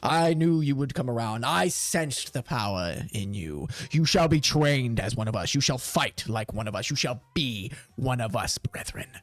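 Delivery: low, solemn welcome rising to a booming call; induct the recruit grandly; theatrical commander's oratory. Acted.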